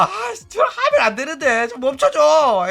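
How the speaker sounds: high-pitched mock whine; 미코 pleading. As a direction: squeal a plea to make it stop. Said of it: "미코가". (높은 소리로 멈춰달라 애원하는 흉내)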